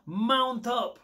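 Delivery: high, loud hip-hop rallying call, slow; hammed-up gangsta cry. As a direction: call the crew like a rap hook. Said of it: "the [name] reference".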